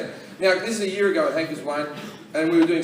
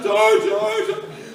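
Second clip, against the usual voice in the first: imitating an actress making a scene; hammed-up begging; melodramatic pleading; 3 semitones higher, 4 dB louder, much slower than usual. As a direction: plead like an overacting wife. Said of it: "She's making a big scene".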